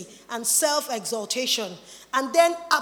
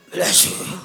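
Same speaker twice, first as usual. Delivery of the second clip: whispered, much lower blessing; mock holy whisper; 9 semitones lower than usual. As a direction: breathe a fake holy blessing. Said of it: "put on an attitude".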